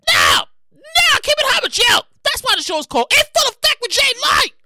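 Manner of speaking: loud, high comic outrage; emphatic shouted refusal. Acted. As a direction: holler it like a fed-up host. Ranting rejection of the ice cream.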